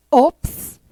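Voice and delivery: ops said as a short surprised English oops; ops, a quick English exclamation of surprise. Shown as a demonstration. Teacher showing the class ops. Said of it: "Esse ops seria o quê?".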